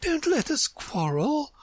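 high, worried queenly plea; anxious. A narrator's reading. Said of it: "said in an anxious tone".

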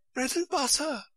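anxious high murmur, repeated; murmured to herself. Acted. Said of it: "in a whisper to herself".

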